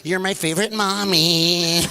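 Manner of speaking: put-on slow, sugary kid voice; sucking up. Kid to mom at party, slow sweet-talk. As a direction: drag it out sweetly to butter mom up.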